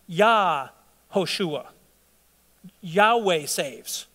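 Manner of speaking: Yah-Hoshua drawn out very slowly, syllable by syllable; slow, deliberate naming of Yah-Hoshua; spelling out the name. Shown as a demonstration. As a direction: say Yah-Hoshua and Yahweh saves slowly so each part of the name lands. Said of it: "I'm gonna change your name to".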